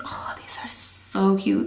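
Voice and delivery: slow hushed whisper. Real, not acted. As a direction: whisper it, savoring each word.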